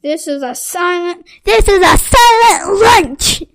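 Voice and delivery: loud, high stern shout. A narrator's reading. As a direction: yell it over the noise. Teacher shouts for silence.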